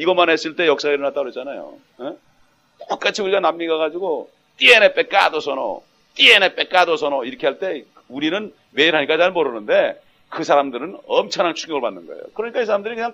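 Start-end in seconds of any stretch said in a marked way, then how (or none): 4.6-7.2 loud, forceful foreign-language proclamation; mimicked street-preaching shout, drawn out at first; imitated missionary cry. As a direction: punch each phrase out to a crowd. Imitates missionary preaching in South America.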